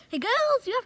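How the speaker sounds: high, loud call across the room. Pretend. Kid calling the girls to deal with the cat. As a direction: shout for the girls to come.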